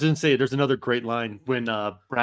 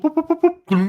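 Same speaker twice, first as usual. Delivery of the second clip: high, louder cartoon boop noises; 7 dB louder than usual. Mimicking a cartoon fall to friends, high bouncy boops. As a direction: make the cartoon slip sound effects. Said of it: "type of noise".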